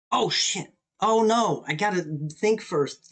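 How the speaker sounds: loud, high cry of dismay; alarmed outburst. Real, not acted. Chess player realizing he moved without thinking, alarmed. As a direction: blurt it out in sudden alarm. Player sees his own blunder.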